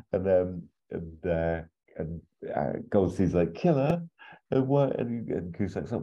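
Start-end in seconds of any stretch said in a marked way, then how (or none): 3.5-5.0 much higher, indignant disbelief; mock baffled. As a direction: play it as a confused innocent. Goldstein baffled by accusation.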